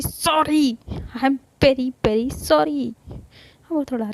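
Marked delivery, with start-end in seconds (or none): 0.0-2.9 louder, pitched-up public apology. Acted. Sarika apologising loudly to Avantika in the street, louder. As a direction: say it loud so everyone nearby hears. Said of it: "loud कहती है".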